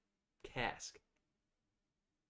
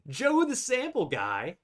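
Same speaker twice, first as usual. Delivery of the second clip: excited; 9 semitones higher, 5 dB louder than usual. Acted. Reads username with flair.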